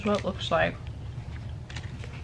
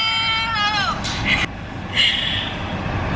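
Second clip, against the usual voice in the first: high laugh. Real, not acted. Opening clip makes her laugh.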